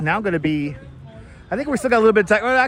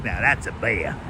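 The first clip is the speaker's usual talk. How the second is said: mock Aussie accent; 8 semitones lower than usual. Crocodile Dundee style quote.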